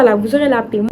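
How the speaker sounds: ordinary talk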